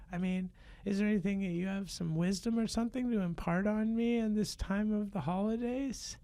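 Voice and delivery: put-on high, curious kid voice; a wide-eyed kid needling Santa; child's voice. Child up late on Christmas Eve questioning Santa, high kid voice.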